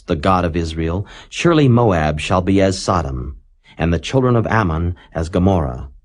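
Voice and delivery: ordinary delivery, nothing marked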